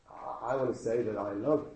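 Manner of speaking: ordinary talk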